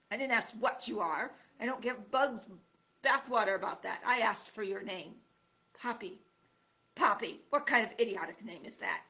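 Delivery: put-on loud, cranky old creature snapping; a crotchety porcupine woken up, annoyed; grumpy old porcupine. Porcupine berates mouse.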